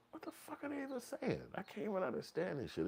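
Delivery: very high, hushed bewildered voice; baffled complaint. Acted. New inmate baffled by echoing yells, soft high-pitched disbelief. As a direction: complain in a small, high squeak.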